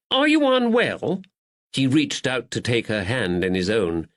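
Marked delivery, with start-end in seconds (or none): 0.1-1.2 high, anxious question; worried. Acted. Duddles frets over Caroline in the carriage, anxious. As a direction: ask as a fretful gentleman.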